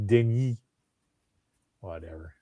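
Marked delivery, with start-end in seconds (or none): 0.1-0.6 Denis said the French way, de-NEE with no final s; French pronunciation. Shown as a demonstration. Podcast host correcting a cohost on the director's name, Denis in French. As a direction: say Denis as the French do, stress on the last syllable. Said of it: "you say it the French way".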